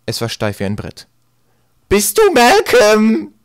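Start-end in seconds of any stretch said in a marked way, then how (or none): 1.9-3.3 very high, loud, drawn-out screech; cheese-grater crone voice. A narrator's reading. Mad old woman to Eddie in the coach, grating screech. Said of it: "mit einer Stimme wie eine Käsereibe".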